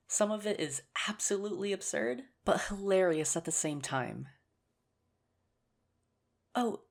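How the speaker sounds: usual speaking voice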